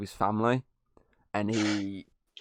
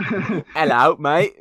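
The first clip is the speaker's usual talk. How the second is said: raised, louder mock Cockney greeting; Cockney accent; 4 semitones higher, 5 dB louder, much slower than usual. Podcaster mocks Caine's supposed New Yorker, in a Cockney accent. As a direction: put on a lightly exaggerated Cockney accent. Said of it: "he's got a fucking Cockney accent".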